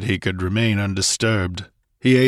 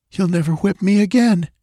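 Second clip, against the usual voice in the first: high, light female character voice; young woman's voice; 11 semitones higher, 4 dB louder than usual. Acted. Girl speaks to Conan, relieved.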